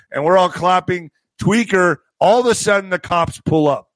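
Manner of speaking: usual speaking voice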